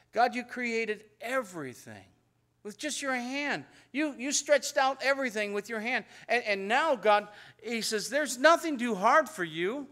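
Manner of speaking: fervent praise. Retold or imitated. Jeremiah praising God before voicing his doubt, fervent. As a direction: lift it up to God with mounting fervor. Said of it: "Notice what he's doing?".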